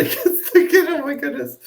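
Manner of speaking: laughing through the words; giggling while talking. Real, not acted. Laughs at the play.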